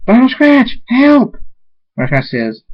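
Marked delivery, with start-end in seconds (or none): 0.1-1.4 high, loud cry for help; screamed call. Acted. Aunt calling Vinyl Scratch for help, screaming. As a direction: scream out the name, then the plea. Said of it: "Althea screams".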